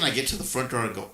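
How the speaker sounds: ordinary talk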